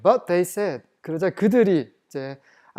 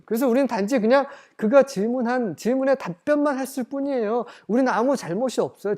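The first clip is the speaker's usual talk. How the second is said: defensive excuse; 7 semitones higher, 4 dB louder than usual. Retold or imitated. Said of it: "지금 그렇게 말하고 있는 거죠".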